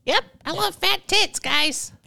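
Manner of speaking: very high silly pet voice; squeaky voice for the cat. Acted. Voicing the cat.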